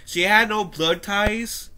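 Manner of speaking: ordinary talk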